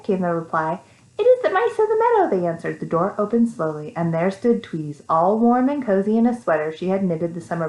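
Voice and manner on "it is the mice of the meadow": high, quick mouse chorus; eager mice. A narrator's reading. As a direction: answer fast and high. Mice answer.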